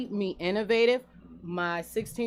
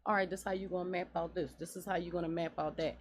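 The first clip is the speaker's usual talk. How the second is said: quick inner planning; 8 dB quieter, faster than usual. Retold or imitated. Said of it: "but I'm also thinking".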